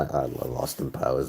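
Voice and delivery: normal talking voice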